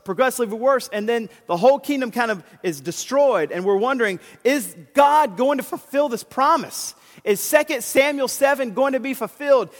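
loud, high, rising sermon fervour; preaching at full force. Acted. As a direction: preach it with growing fire. Preaching builds to climax.

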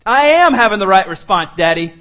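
loud, defiant insistence on "am"; petulant five-year-old. Acted. Five-year-old arguing with Daddy, loud defiance. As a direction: talk back loudly like a stroppy child. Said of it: "your five-year-old say that to you".

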